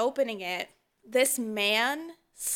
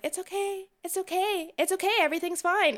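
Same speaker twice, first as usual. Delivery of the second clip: higher, shaky-sounding repeated okay; flustered reassurance; 9 semitones higher, faster than usual. Retold or imitated. Telling how she calmed the apologizing man, high and flustered. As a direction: brush it off while rattled. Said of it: "I was just like".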